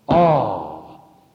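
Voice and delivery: "All" punched loud and low; stress on all. Shown as a demonstration. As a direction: hit "All" hard as the answer. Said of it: "Emphasis on the word all".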